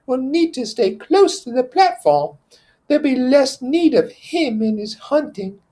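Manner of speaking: put-on sensible boy taking charge; Piggy's voice. Piggy organizing the assembly without Jack.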